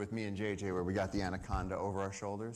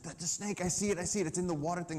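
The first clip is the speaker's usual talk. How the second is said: excited; 8 semitones higher, faster than usual. Retold or imitated. Staff member urgently reporting snake.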